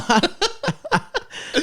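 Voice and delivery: high, drawn-out mock curse with a laugh; hammed-up curse. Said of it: "said, "No".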